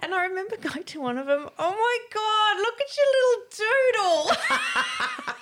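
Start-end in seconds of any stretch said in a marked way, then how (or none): 1.6-5.3 put-on high, loud, slow cooing over the boys; drunk mock mothering. Drunk at a party, teasing naked boys in a high laughing coo. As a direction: coo at them like a tipsy mum, laughing.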